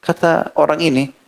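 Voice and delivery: normal talking voice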